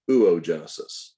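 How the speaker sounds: "oogenesis" opening with OO, then a long O; wrong oo-oh pronunciation. Shown as a demonstration. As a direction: say "oogenesis" wrongly as oo-oh-JEN-uh-sis. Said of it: "It is not pronounced".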